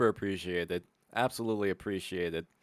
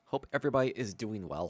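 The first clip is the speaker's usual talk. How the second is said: usual speaking voice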